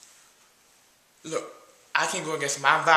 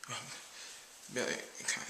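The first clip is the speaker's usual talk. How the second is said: whisper; 5 semitones lower, 16 dB quieter, much faster than usual. Acted. Whispering aside during awkward payment.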